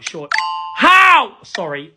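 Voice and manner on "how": exasperated outburst. Real, not acted. Frustrated at the video.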